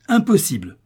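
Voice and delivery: « Impossible » crié, plus haut et plus fort; cri. Acted. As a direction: crier le refus au visage des fils. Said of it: "cria maître Béjanet". (crier le refus, plus haut et plus fort)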